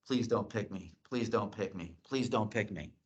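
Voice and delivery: hushed, pleading please don't pick me; pleading whisper-soft. Pretend. Student praying silently not to be called, hushed pleading. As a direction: beg under your breath not to be called.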